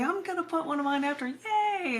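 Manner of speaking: high, fast, gleeful squeal of a retold self; bubbling over with glee at the idea; quick, chirpy cheer ending in a high yay; excited yay. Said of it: "and I say".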